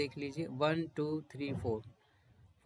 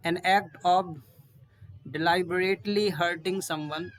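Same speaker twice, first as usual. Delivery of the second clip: very slow and a bit louder; slow dictation; 3 semitones higher, 5 dB louder, much slower than usual. Shown as a demonstration. Teacher dictating the meaning of torture, slow. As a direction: spell out the definition slowly. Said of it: "reads the definition slowly".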